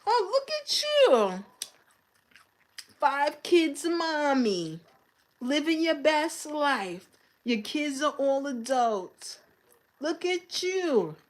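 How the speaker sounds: high, slow mock-admiring tone; fake-praise her with sarcasm; sarcastic praise. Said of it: "I'm just looking at her like".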